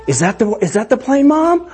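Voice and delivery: put-on high excited kid voice asking; young daughters straining to spot dad's plane; eager little girls. Little girls at airport window.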